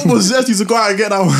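high, loud, fast mum voice; mum shouting. Retold or imitated. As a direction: yell it in mock disbelief like mum. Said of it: "She's going".

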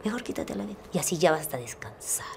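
whispered, coaxing dark voice; whispering temptation; sinister inner voice. Retold or imitated. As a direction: tempt her softly to give up. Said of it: "Y ahí me dijo".

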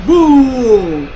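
loud movie-trailer explosion; huge cinematic boom; Michael Bay style boom. Pretend. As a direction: belt out a big boom. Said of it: "Michael Bay style".

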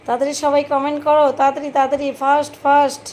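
high, loud and hurried; urgent loud urging. Real, not acted. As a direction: rush the viewers to comment. Urging viewers to comment fast.

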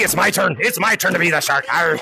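low pirate-like shark voice; playful shark villain. Pretend. Shark player warning gorillas it's his turn, low mock menace.

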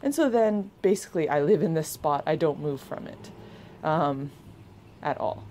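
ordinary talk

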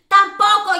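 loud, high outburst of frustration; exasperated shout. Real, not acted. Host finding yet another player silent, exasperated shout. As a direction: burst out, fed up.

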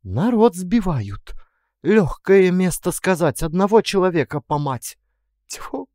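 indignant cook. Acted. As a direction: scold and spit in disgust like a village cook. Indignant cook voiced in story.

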